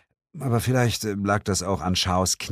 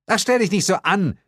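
high, quick dismissive retort; brisk exasperated woman's voice; impatient scolding; 13 semitones higher, 4 dB louder, faster than usual. Acted. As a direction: brush off his whining.

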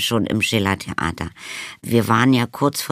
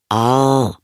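louder, drawn-out hollow cry; muffled cry; 3 semitones higher, 5 dB louder than usual. A narrator's reading. Vampire tugged inside cupboard, muffled cry. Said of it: "schrie eine dumpfe Stimme aus dem Schrank".

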